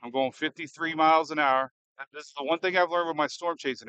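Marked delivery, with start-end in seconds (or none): none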